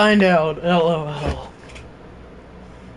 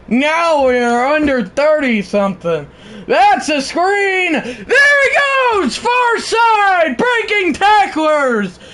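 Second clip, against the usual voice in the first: live play-by-play; 5 semitones higher, slower than usual. Acted. Streamer calling the Alabama drive to chat, excited. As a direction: build the call to a yell as the pass sails incomplete.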